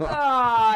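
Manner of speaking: loud, high drawn-out "ah"; mock scream. Retelling the kids' reaction, mock scream. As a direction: imitate a loud cry of shock.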